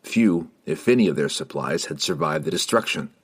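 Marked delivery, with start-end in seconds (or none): none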